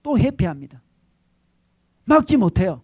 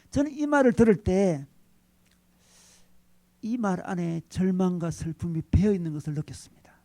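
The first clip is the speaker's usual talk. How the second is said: normal talking voice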